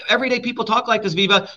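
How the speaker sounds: usual speaking voice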